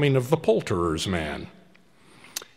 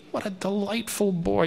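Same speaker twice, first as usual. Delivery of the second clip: usual speaking voice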